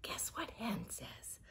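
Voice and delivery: teasing whisper. Real, not acted. Teacher teasing the children, whispering. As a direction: whisper it like a secret. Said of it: "teaser to listeners".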